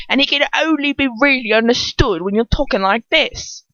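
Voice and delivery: put-on a rough London-accented Death Eater teasing; Cockney Dolohov. Death Eater urging a friend to mock Dolohov, in Cockney. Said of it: "I haven't said his voice in".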